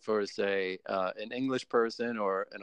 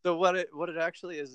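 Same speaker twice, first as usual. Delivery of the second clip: normal talking voice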